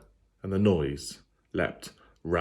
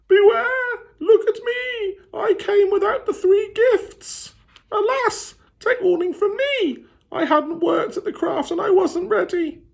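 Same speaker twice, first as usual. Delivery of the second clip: very high, loud eerie wail; wailing ghost; 5 dB louder than usual. Acted. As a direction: wail a warning from the dead. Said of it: "she heard them wailing".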